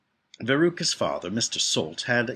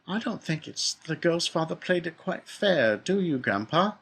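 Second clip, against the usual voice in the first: put-on higher, slower boy's voice; child character, pitched up and unhurried; young Charlie; 7 semitones higher, slower than usual. A narrator's reading. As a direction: ask it as a thoughtful small boy. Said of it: "said Charlie".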